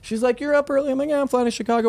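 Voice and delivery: high, bright small talk; sing-songy pleasant greeting; chirpy friendly chat. Retold or imitated.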